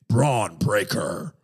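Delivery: very slow, drawn-out fighting game announcer; hammed-up arcade announcer call; Killer Instinct announcer. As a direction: announce a fighter's name, stretching every syllable. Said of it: "something straight out of Killer Instinct".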